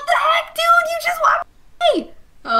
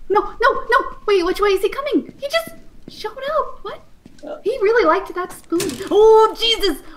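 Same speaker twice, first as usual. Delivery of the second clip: panicking gamer; 10 semitones higher, 3 dB louder than usual. Real, not acted. Chased by the monster in game.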